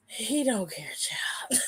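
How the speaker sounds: whispery, breath-only repeat trailing into a laugh; hushed aside. Real, not acted. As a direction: say it under your breath in disbelief. Repeats remark under breath, amused.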